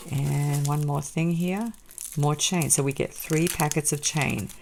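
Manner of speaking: ordinary talk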